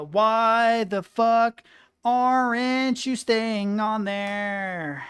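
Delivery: slow, loud, drawn-out exasperation; loud, slow exasperated complaint; frustrated yell. Real, not acted. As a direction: drag every word out at the stubborn piece. Frustrated at toy piece.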